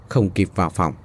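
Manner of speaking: plain reading voice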